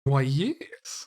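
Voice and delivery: high put-on shopkeeper lady voice; a man doing a posh lady. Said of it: "Oh, God. That voice".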